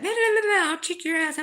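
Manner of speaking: high, loud mocking bar girl; mock a drunk girl picking fights; belligerent drunk. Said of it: "Those little girls at the bar".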